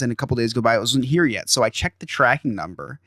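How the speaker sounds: ordinary delivery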